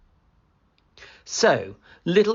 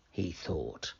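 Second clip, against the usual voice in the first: ordinary delivery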